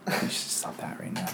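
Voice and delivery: breathy whisper in the ear. Acted. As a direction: whisper it right into their ear. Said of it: "enjoy my whispered voice in your ear".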